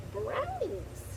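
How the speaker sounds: excited answer. Acted. Answering in a squeal.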